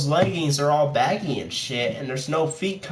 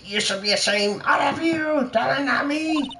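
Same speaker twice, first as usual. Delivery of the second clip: put-on high, fussy scolding old lady; prissy old lady; 11 semitones higher than usual. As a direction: scold like a haughty old dame. Voicing the old witness.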